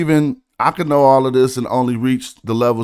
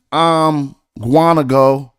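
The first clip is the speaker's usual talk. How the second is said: slow, each syllable louder to match Guantanamo; rhyme demo; 4 dB louder, much slower than usual. Shown as a demonstration. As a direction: lay out "I'm Guanago" syllable by syllable. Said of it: "then you rhymed all four syllables".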